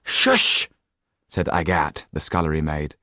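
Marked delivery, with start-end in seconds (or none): 0.0-0.7 a hissed, breathy shush; urgent shush. Acted. Scullery maid hushing loud kitchen talk, hissed shush. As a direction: hush them at once.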